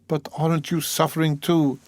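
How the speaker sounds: high, pleading woman's question; cried out. A narrator's reading. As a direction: cry it with pained sympathy. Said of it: "cried Sonia".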